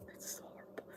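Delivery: breathy whispered confession. Real, not acted. Host confessing an embarrassing habit, whispered. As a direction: whisper it like a guilty secret.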